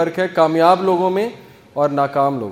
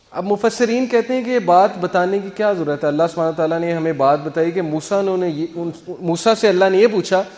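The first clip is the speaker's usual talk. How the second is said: ordinary talk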